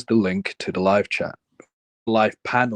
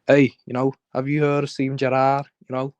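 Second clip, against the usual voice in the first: raised-pitch Scouse football patter; joking Scouse impression, pitched up; Scouse accent; 4 semitones higher than usual. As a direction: commentate on the match in a put-on Liverpool accent. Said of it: "doing an accent for no".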